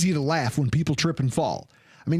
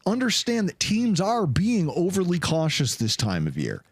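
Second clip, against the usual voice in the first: ordinary delivery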